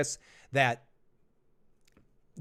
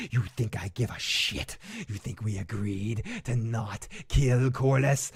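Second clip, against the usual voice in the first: low, hushed hammy menace; scenery-chewing villain; 6 semitones lower, 5 dB quieter than usual. Acted. Villain vows to kill Corlys, low hammed-up menace. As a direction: drop deep and soft, relishing every threat. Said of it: "eating scenery".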